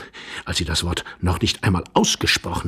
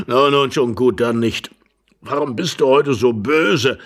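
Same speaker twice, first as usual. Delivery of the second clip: put-on louder old man's voice; placating old husband; 4 semitones higher, 5 dB louder than usual. Old husband asks why wife is cross, placating.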